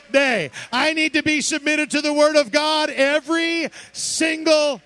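loud, ringing pulpit cry; fiery camp-meeting sermon; full-force preaching. Acted. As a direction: preach it to the congregation, building.

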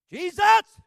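sudden high-pitched bellow; loud prayer shout. Retold or imitated. Preacher praying over the burned man, explosive high shout. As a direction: imitate a preacher bursting out at full volume. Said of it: "He was loud".

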